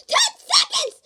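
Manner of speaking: high, loud laughing shout; real last-seconds panic; panicked shout. Real, not acted. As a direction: shriek it with a laugh. Panicking at the countdown.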